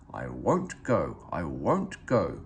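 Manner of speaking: low, slow sulky grumble; a sulky engine grumbling under its breath. A narrator's reading. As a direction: dig your heels in, grumbling low. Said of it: "grumbled Gordon".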